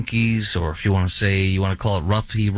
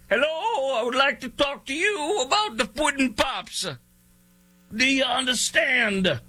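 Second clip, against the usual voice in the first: much higher, thin put-on Cosby voice; bad Bill Cosby impression. Radio host doing a horrible Cosby impression, much higher. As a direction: ham up a terrible Cosby pitching Puddin' Pops. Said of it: "This is my horrible impersonation".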